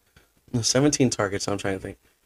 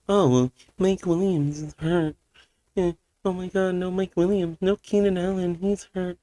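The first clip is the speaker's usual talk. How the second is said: whiny mock excuse; 10 semitones higher than usual. Mocking Chargers fans whining about injured receivers, high whine. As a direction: squeal the excuses like a sore fan.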